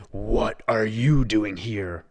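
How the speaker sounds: slow, loud beast voice; roar. A narrator's reading. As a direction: growl it at the intruder. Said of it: "roared the beast".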